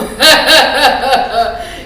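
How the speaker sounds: loud villain laugh; big hammy wolf guffaw; booming wolf laugh. Acted. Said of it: "c'est le loup". (rire tonitruant de loup)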